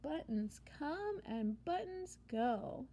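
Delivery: lively picture-book read-aloud; animated storybook narrator; reading to children. A narrator's reading. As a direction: read it like storytime for little kids.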